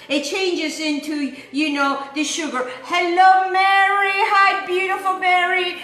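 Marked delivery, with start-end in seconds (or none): 2.8-5.8 a little higher, louder sing-song hello; happy greeting. Real, not acted. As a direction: call out a welcome to a viewer. Greets a viewer in chat.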